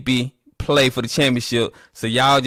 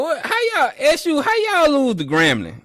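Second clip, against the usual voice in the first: mock disbelief, much higher. Real, not acted. Mocking Southern fans again.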